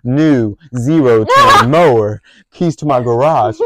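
a hint of a boastful teacher listing his keys; lightly voiced bragging teacher. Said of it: "he was like".

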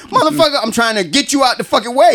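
hammed-up annoyance at the clerk; exasperated snap. Exasperated customer snapping at clerk.

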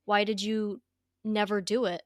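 usual speaking voice

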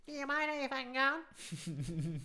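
high, drawn-out Aussie bogan voice; high-pitched bogan. Acted. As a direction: imitate a bogan catching someone off guard. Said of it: "Those real high-pitched bogans".

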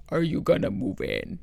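low, slow imitation of his deep voice; put-on deep voice, slow; deep-voiced man. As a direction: drop low and drag it out, mimicking him. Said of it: "he had, like, the deepest voice".

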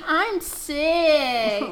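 whiny, pitiful man's voice. Pretend. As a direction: whine the title like a sick man. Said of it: "that sound like a whiny man's voice".

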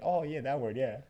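high, loud imitation of dawning recall; wife suddenly remembering. Husband imitating his wife remembering the word, lightly mocking. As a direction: act out a breezy moment of remembering.

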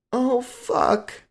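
high moan, drawn out. A narrator's reading. Lover moaning to him, high and drawn out. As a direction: let it slip out as pleasure peaks. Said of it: "she moaned".

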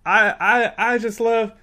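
high, loud, badly faked criminal swagger; putting it on. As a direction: overact trying to pass as a crook. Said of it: "he's putting it on a little bit".

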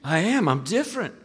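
imitating an overjoyed Glasgow pastor on the phone; excited Barry. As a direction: gush it out like a pastor who's been changed. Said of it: "He says".

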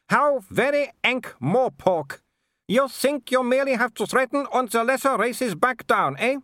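high-pitched imperious aristocrat; scornful Baroness. A narrator's reading. Baroness scorns the threatening visitors, high and haughty. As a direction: look down on them, voice pitched far up.

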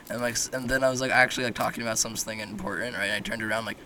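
ordinary talk, nothing marked

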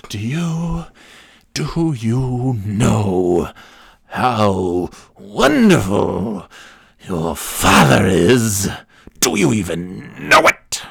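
slow, drawn-out sanctimonious church woman; mock shaming. Church woman shaming the preacher's son, mock shaming. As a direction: stretch each word out in exaggerated reproach. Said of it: "shaming me".

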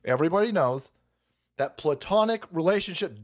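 normal talking voice

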